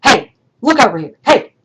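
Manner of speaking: loud, rude calling out; rude hey. Retold or imitated. Teacher imitating a student's rude hey, loud and brusque. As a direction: call out rudely to get attention. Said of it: "That's how it comes across".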